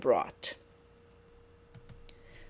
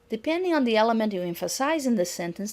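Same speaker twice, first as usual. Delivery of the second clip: ordinary delivery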